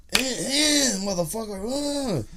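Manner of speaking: put-on high, slow croaking old-man curse; imitating an old man giving the finger; cranky old man cursing. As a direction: drag out the insult like a furious old man. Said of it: "was like".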